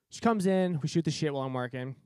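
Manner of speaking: ordinary delivery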